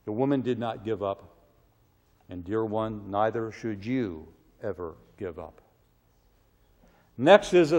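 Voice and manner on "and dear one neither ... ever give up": tender appeal. Real, not acted. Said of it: "tender appeal to listener".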